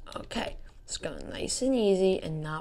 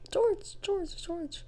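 high squeaky call; high silly voice; 13 semitones higher, much slower than usual. Pretend. Calls out in a high voice.

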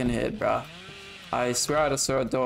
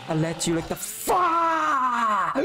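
taunting shout; 10 semitones higher, much slower than usual. Real, not acted. Gamer taunting his beaten opponent, drawn-out yell. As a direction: stretch the last word out and yell it.

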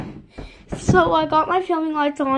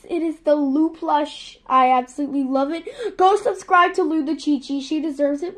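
ordinary talk, nothing marked

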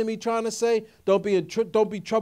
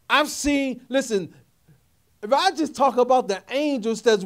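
raised, emphatic sermon voice building in force; impassioned preaching; 5 semitones higher, 6 dB louder than usual. Real, not acted. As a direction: preach it with mounting conviction, pitch climbing.

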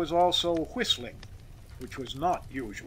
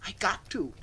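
higher, timid insistence; faltering; 5 semitones higher than usual. A narrator's reading. Timid worker explains himself to boss, faltering. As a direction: plead it uncertainly. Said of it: "faltered Spitzer".

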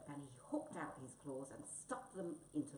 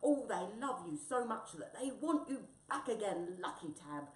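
loud, raised, delighted cry; exclaiming over applause; 4 semitones higher, 8 dB louder than usual. Acted. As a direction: call it out with excitement, easing at the end. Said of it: "Wombat cried".

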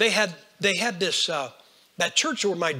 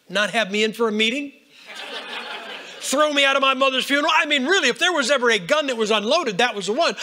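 higher and louder, laughing at the threat; amused mocking outrage; 6 semitones higher, 4 dB louder than usual. Real, not acted. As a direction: scoff at the toothless threat, laughing.

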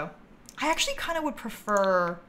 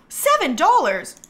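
pleased surprise; 5 semitones higher, 6 dB louder than usual. Real, not acted. Cheap wallpaper found.

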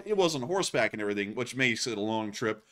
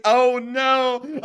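mock dismay; 11 semitones higher, 7 dB louder than usual. Retold dismay at the slip.

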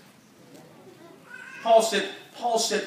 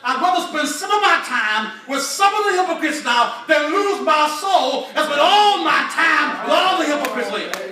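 louder, higher preaching shout; quoted preacher's shout; 4 semitones higher, 5 dB louder than usual. Retold or imitated. Retelling another preacher to the church, full shouted preaching. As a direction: belt it out like a fired-up preacher. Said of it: "As one preacher said".

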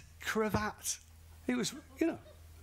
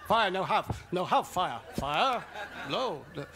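louder, laughing broken English; broken English mockery; 4 dB louder than usual. Mocking how the British answer a European, loud and laughing. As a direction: laugh through a mock broken-English reply. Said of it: "We can't even say".

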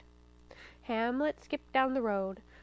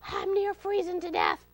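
high, pitiful freezing-fox voice; begging; 6 semitones higher than usual. A narrator's reading. Fox claims he is freezing outside, begging. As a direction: plead as if frozen through. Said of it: "he begged".

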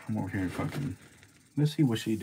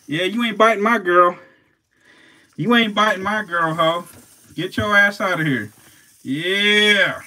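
loud, high cheering on his snake; hyped yelling; 7 semitones higher, 7 dB louder than usual. Real, not acted. Shouting at the rival snake, hyped and loud. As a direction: hype her up, shouting at the other snake.

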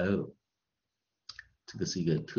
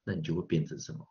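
normal talking voice

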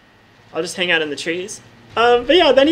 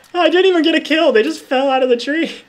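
louder and higher, still giggly; amused disbelief; 7 semitones higher, 4 dB louder than usual. Real, not acted. Player still buzzing after enemies fell, loud and high. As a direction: marvel that they died without her.

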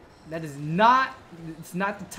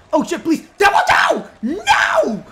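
high, loud desperate cry; panicked yell; 7 semitones higher, 12 dB louder than usual. Real, not acted. Player knocked flying, begging his companion, panicked yell. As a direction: scream for help as you go down.

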